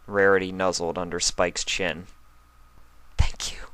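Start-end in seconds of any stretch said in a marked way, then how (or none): 3.2-3.7 tearful whispered thanks; soft whisper. Acted. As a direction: breathe it out in a whisper. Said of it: "she cried softly".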